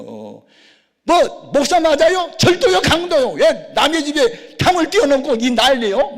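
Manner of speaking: high, outraged shouting; angry yelling. Retold or imitated. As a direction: yell at the intruder over the wall. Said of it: "막 그러면서 막 고함을 지르면". (격분해서 높게 지르는 고함)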